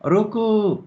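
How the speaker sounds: high, drawn-out halt call; acted cry, pitched well up. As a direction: call out to stop the jeep. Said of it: "कुछ ऐसा देखा कि चीखे".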